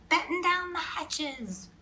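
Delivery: high, slow pirate command; lightly voiced captain calling out; shouted order. A narrator's reading. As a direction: drag the order over the storm. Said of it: "Braided Beard shouted".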